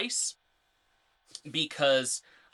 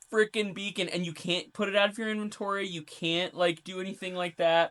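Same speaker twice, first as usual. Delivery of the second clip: exasperated player; 6 semitones higher, 3 dB louder than usual. Acted. Acting an exasperated player's complaint.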